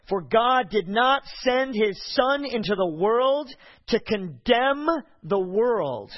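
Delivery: preaching emphasis. Acted. Preaching scripture at full force.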